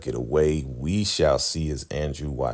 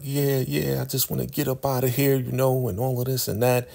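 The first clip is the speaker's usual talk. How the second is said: higher, quick casual mock voice; lightly voiced, shrug it off fast; lightly voiced brush-off; 8 semitones higher than usual. [name] shrugs off the praise, in a quick higher voice.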